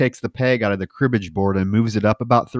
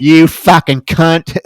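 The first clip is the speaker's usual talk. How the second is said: slow, louder hammed-up curse; mock furious insult; 4 semitones higher, 10 dB louder, slower than usual. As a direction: drag out the insult in fake rage. Retells Sully's furious outburst.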